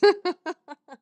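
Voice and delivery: high burst of real laughter; a bright, amused laugh. Real, not acted. Listener laughing after the eulogy reading, high laugh.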